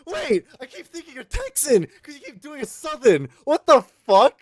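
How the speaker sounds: high, loud disbelieving shout; shocked. Real, not acted. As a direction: yell in sudden disbelief. Shocked outburst at friend.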